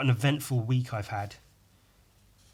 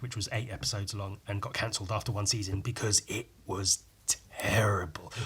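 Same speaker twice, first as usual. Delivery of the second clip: whispered aside; 3 semitones lower, 5 dB quieter than usual. Real, not acted. Trashing the American remake, whispered aside. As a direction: drop to a conspiratorial whisper.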